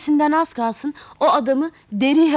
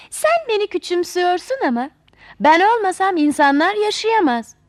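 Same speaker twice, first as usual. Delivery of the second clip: put-on higher, louder and quick, a spoiled girl goading an old man; proud teasing girl; 4 semitones higher, 5 dB louder than usual. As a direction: tease him, full of yourself. Talih taunts the old man.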